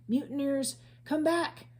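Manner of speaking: shouted. A narrator's reading. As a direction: yell after the fleeing crew. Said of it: "shouted Captain Bones".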